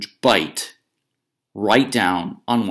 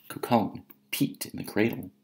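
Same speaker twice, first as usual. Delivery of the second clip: usual speaking voice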